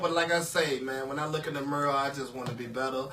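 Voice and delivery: mock sincere. Mock serious sign-off.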